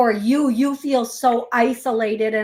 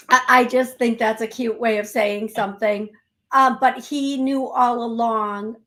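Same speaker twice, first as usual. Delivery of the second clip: ordinary talk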